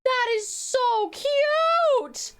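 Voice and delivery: high, very slow gushing over the art; overjoyed squeal. Real, not acted. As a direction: melt with joy, almost in tears. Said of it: "I'm so excited".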